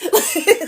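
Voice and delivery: short high giggle; a quick bright giggle. Real, not acted. Giggling between points to viewers. Laugh alone.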